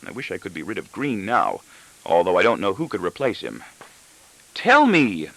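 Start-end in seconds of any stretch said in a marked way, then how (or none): 4.5-5.3 high, sharp demand from the wife; a wife repeating herself, shrill. A narrator's reading. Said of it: "my wife repeats shrilly".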